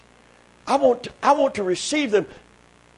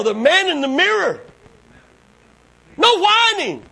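very high, incredulous pulpit cry; full-force preaching; 9 semitones higher than usual. Acted. Preacher talking to the man in the mirror, high-pitched preaching. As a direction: challenge the congregation in a high holler.

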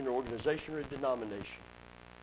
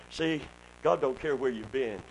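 ordinary delivery

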